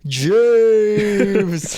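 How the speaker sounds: high loud call of the name, redone; shouting a name on request. Shown as a demonstration. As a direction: shout the name again as asked. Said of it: "Can you do it for me?".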